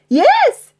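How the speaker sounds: very high loud cheer; shout. Acted. Pirates cheering about a cook, shout. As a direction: cheer it together. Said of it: "shouted the pirates".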